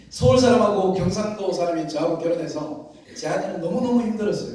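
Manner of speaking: ordinary talk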